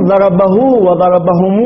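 slow "ضربه وضربهم" with long -huu and -humuu; waw added in connection. Shown as a demonstration. Teacher reading the rule's example, "ضربه وضربهم" with lengthened final u. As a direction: draw out the last vowel of "ضربه" and "وضربهم". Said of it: "في من ألحق الواو بهما وصلا".

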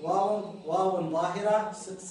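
واو، واو ظاهرة drawn out slow and loud; emphatic slow. Shown as a demonstration. A teacher slowly stressing واو for students. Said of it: "مثال ما دامه واو".

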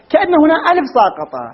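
ordinary delivery

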